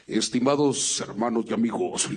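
voz impostada. Acted. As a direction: fall back into the deep put-on voice. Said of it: "ya estaba agarrando ese hábito". (voz grave impostada de locutor)

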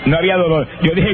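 usual speaking voice

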